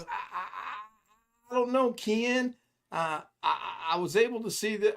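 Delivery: high, slow, stammering put-on voice of a flustered caller; imitating Alex. Alex stammers his excuse to Ken in a high voice. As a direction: whine it out high and halting. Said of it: "he goes".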